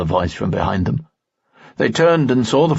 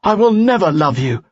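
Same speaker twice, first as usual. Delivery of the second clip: raised, higher defiant outcry; cried out; 11 semitones higher, 4 dB louder than usual. A narrator's reading. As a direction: fling the refusal at him. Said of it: "cried Giui".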